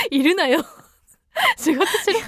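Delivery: slow, incredulous comic retort; laughing mock scolding. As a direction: scold the absent boss through laughter. Mock scolding of boss found online.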